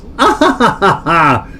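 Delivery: short high laugh at himself; embarrassed laugh. Real, not acted. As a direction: laugh it off, a bit sheepish. Laughs at own blindness.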